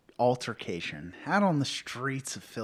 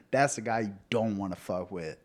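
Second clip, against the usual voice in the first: normal talking voice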